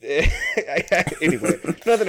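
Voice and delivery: slow, laughing "anyway". Real, not acted. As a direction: let it dissolve into a laugh. Laughing, drawn out.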